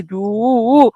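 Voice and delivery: normal talking voice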